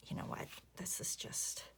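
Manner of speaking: breathy muttered aside to herself; real whispered aside. Real, not acted. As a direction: drop to a whisper mid-thought.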